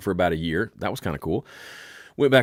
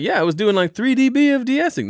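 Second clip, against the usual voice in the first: high smug mixer voice; 5 dB louder than usual. Retold or imitated. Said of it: "They're like".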